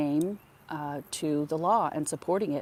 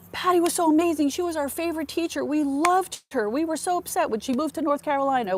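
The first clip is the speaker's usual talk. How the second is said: much higher, gushing praise; gushing father; 12 semitones higher, 4 dB louder than usual. Retold or imitated. Father tells Patty's mom how much they loved her, gushing. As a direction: rave about her like a fan. Father gushes about favourite teacher.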